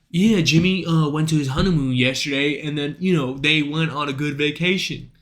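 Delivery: lightly voiced water-cooler chatter; small talk impression. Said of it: "cooler talk".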